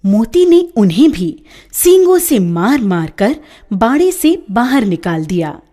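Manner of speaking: usual speaking voice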